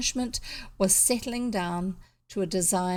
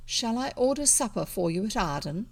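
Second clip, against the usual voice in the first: plain reading voice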